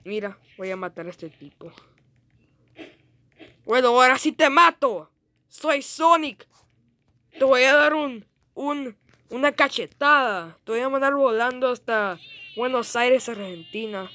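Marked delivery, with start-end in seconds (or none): 3.6-13.3 loud, high battle boast; playful threat. Pretend. Gamer threatening an opponent in play, loudly hyped. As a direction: talk big as you charge in.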